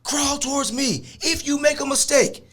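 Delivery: high-pitched imitation of barked cop threats; threatening cop. Cop ordering the crying man to crawl, high-pitched threats.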